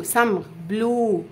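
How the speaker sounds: بلو drawn out slowly as bloo; long oo. Shown as a demonstration. Teacher slowly sounding out بلو for students. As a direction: stretch بلو on oo. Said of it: "او".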